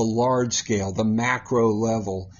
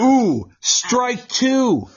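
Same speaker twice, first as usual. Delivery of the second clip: dramatic strike count; 10 semitones higher, 4 dB louder, much slower than usual. Real, not acted.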